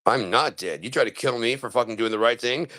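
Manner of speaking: ordinary talk, nothing marked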